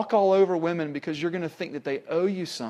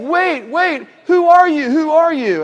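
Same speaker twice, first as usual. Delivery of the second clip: high, loud, imitated woman's cry; urgent film heroine; 11 semitones higher, 10 dB louder than usual. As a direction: mimic her alarmed questioning, pitched way up. Voicing film heroine, urgent questioning.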